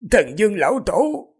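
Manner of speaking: high-pitched bystander calling the elder; gentle call. A narrator's reading. Bystander calls to the grieving king, high gentle call. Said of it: "Người bên cạnh khẽ gọi".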